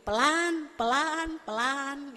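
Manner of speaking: drawn out, slow and deliberate; exaggerated slowness. Shown as a demonstration. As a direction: stretch every word to show the slowness. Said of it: "saking atur pelan-pelannya". (sangat pelan, diulur-ulur)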